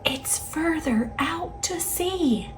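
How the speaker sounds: hushed unvoiced whisper. A narrator's reading. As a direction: breathe it out as a secret hint. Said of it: "a hidden voice whispered".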